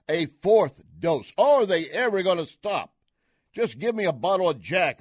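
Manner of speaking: loud, incredulous and fed up, pitch jumping up; exasperated radio-host outburst. Real, not acted. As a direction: throw up your hands out loud at the absurdity. Exasperated rant about endless boosters.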